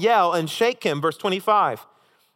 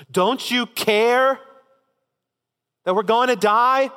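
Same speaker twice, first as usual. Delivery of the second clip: desperate accusing plea; 6 semitones higher, 4 dB louder, slower than usual. Acted. Disciples accusing Jesus.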